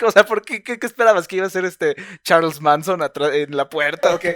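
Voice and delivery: high, loud, laughing incredulity; retold laughing teasing. Teasing mother over her fright at the door, laughing. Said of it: "cagándome de la risa de que fue como de".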